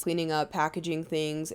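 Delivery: normal talking voice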